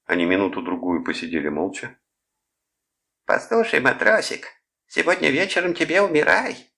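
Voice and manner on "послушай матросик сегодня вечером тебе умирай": very high, louder mock-Japanese taunt; caricatured Japanese accent, pitched right up in falsetto. A narrator's reading. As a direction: taunt in a shrill falsetto imitation of Japanese speech. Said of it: "высоким фальцетом, подражая речи японцев".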